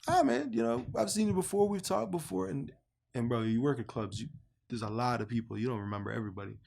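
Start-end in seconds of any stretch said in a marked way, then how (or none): 0.1-2.5 much higher, eager voice of the stranger; upbeat stranger greeting you like an old friend; friendly stranger. Retold or imitated. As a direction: play the overly friendly guy, pitched way up. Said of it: "He was like".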